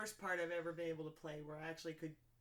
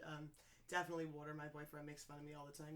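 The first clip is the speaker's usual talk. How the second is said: usual speaking voice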